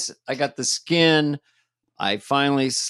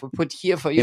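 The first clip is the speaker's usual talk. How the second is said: a lightly done bad Norwegian accent; mock Norwegian accent, barely there; half-hearted Norwegian accent. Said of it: "I'm doing a Norwegian accent".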